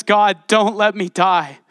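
loud, drawn-out plea for life; mock plea. Preacher imitates Gideon begging God for his life, mock desperate plea. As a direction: beg slowly and loudly, hammed up. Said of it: "And so he says".

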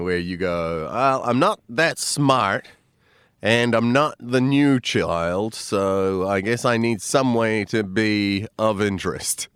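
ordinary talk, nothing marked

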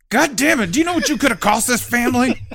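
loud, high, fast furious rant; angry working dad. Pretend. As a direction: chew the kid out for the cost. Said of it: "As opposed to".

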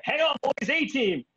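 put-on rally the boys like an action hero; hammed-up A-Team battle cry; gung-ho rallying cry. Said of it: "then I was like".

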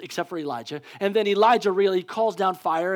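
usual speaking voice

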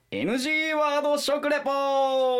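high, slow announcer call; title announcement. Pretend. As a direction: announce the segment title grandly.

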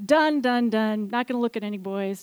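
a student swearing off boys, hammed-up pronouncement; pontificating. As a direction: declare it like a grand vow. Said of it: "pontificating to myself".

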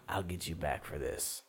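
whispered threat under breath; muttered threat. Acted. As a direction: mutter the revenge quietly.

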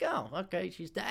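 soft, offhand shrug of a line; playing it cool. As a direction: say it like nothing could faze you. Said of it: "I was cool".

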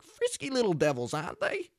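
high, loud Australian impression; Steve Irwin. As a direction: imitate a dorky, delighted Australian animal lover. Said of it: "he's like".

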